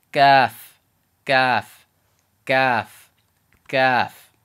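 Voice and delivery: "Gaf" as a loud, unaspirated k plus "af"; Arabic kaf. Shown as a demonstration. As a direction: punch out "gaf" with no puff of air on the first sound. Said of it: "once you say that, you finish off".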